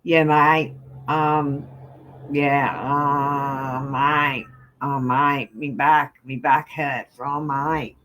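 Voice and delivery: put-on light Australian accent, drawn-out mate; a hammed-up Aussie complaining of his back. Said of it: "you want to speak Australian for you guys?".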